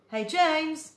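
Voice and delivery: high, loud call of a name; shouted. Acted. Tom calling James loudly across the garden, a high shout. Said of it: "he had ever shouted".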